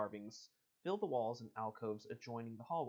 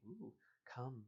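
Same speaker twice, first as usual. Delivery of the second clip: creepy; 7 dB quieter than usual. Acted. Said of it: "She got real creepy real fast".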